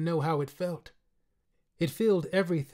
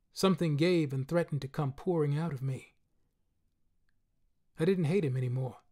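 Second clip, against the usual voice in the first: plain reading voice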